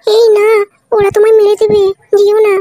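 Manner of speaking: high, loud pleading cry; shrill frightened wife begging; frantic plea. Acted. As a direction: beg him not to go, panicked. Wife begging him not to go.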